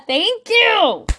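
ordinary talk, nothing marked

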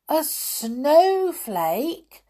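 high, drawn-out questioning exclamation; astonished cry. A narrator's reading. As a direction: cry it slowly in amazement. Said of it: "cried Peter".

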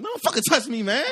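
laughing, loud and high; loud high-pitched protest; loud high outburst. Retold or imitated. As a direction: snap back loud like an angry cousin. Cousin snapping at him.

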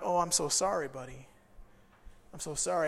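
soft, low, subdued apology; remorseful apology. Retold or imitated. Student seeing the news, apologizing to the boy, hushed remorse. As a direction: drop the voice and apologize gently.

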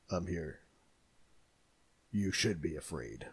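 low, quiet tough-guy voice; menacing shark voice. Pretend. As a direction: growl it like a movie shark. Voicing Mako the shark.

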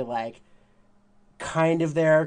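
normal talking voice

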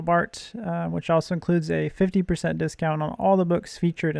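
polished, upbeat host announcement; podcast intro read. Acted. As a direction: read a scripted intro to listeners, evenly projected. Host reads scripted podcast intro.

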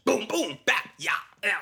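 high, punchy mouth-made impact noises; vocal cartoon punches; fight sound effects. Shown as a demonstration. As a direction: act out each blow as a comic sound. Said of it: "I'm talking about clean sound effects".

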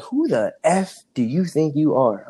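a hint of a stern coach snapping, slowed down; lightly voiced coach demanding an answer; the coach mildly confronting the freshman in front of the team; imitating an angry coach. Coach repeating the challenge.

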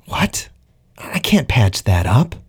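jolted awake by the bad news; suddenly alert. A narrator's reading. Pilot hears of crewmate's collapsed lung, suddenly alert and protesting. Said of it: "suddenly feeling quite alert".

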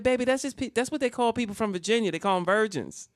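high-pitched explaining grandma, easing down; breezy fibbing. Retold or imitated. Grandmother doubling down on her lie, high-pitched innocent explaining. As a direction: insist on the made-up explanation. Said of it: "instant lie".

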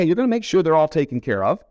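ordinary talk

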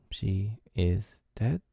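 slow, soft, hesitant rising question; uncomfortable. A narrator's reading. Peter facing Wendy's still body, uneasy. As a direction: venture it haltingly, softly, ending upward. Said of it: "he said uncomfortably".

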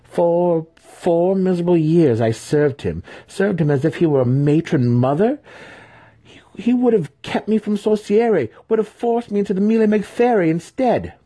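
very high, loud, aggrieved outburst; bitter rant. A narrator's reading. Son venting four years of servitude to his mother, bitter. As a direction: let the grievance climb into a high, forceful tirade.

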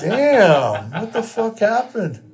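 high, loud disbelieving question; loud high shocked question; loud stunned question. Retold or imitated. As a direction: blurt it out in mock horror. Retold shock at photo.

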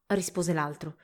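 usual speaking voice

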